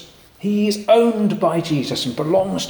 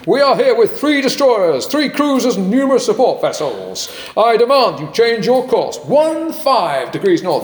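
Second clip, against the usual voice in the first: higher, commanding American captain voice; American accent; 5 semitones higher than usual. Acted. US carrier captain threatening the Canadians, commanding American accent. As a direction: pull rank in a hammed-up American accent. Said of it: "I'll do my best American accent".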